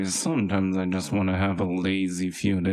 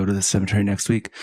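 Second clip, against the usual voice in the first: usual speaking voice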